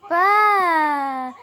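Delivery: sheep bleat. Shown as a demonstration. Mom models sheep sound.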